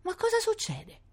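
put-on very high, small child's voice; frightened little girl. A narrator's reading. Scared sister asking what is happening, high frightened voice. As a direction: squeak it out, scared.

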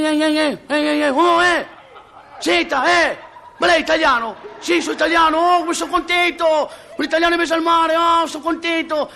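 very high, long-carrying holler; comic castaway yelling on one high pitch; calling from afar. Acted. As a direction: shout joyfully to someone far across the water. Castaways hail each other from afar.

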